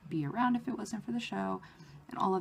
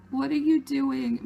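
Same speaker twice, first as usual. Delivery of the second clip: higher, louder and slow, aghast; stunned disbelief; 7 semitones higher, 7 dB louder, slower than usual. Retold or imitated. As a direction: gasp it out in shock. Shocked at huge letters.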